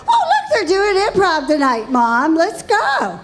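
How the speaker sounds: high, bubbly, with a laugh; excited daughter. Retold or imitated. Daughter luring mom into improv night, high and eager. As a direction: coax Mom in brightly. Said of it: "She, she goes".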